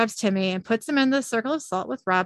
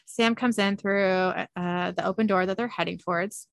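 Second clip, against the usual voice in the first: ordinary talk, nothing marked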